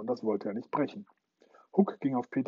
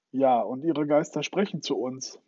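plain reading voice

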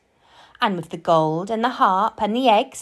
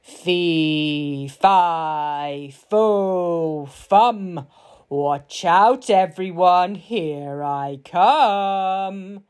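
big booming giant voice with long-held words; roaring giant; much slower than usual. A narrator's reading. Giant announcing himself before supper, roaring and drawn out. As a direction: roar it out, stretching the last word. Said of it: "roared the giant".

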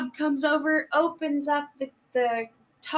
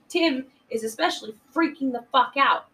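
ordinary delivery

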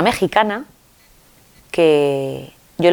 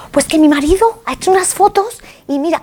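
excited Mexican woman; 11 semitones higher, 4 dB louder than usual. Retold or imitated. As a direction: call out to her friend, thrilled to share. Imita a la mexicana. (la mexicana, muy aguda y emocionada, llamando a Inma)

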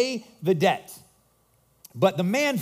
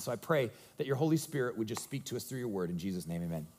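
quiet prayer; 8 semitones lower, 8 dB quieter than usual. Acted. Pastor praying softly over his church, hushed. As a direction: pray gently over the congregation.

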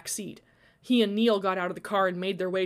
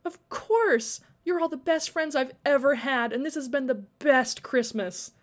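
higher, gushing delight; happy, lifted voice; delighted reassurance; 6 semitones higher than usual. A narrator's reading. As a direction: reassure her eagerly.

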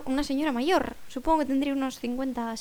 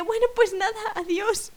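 higher-pitched breezy goodbye; acted farewell; 8 semitones higher than usual. Older woman leaving the stranger, breezy goodbye.

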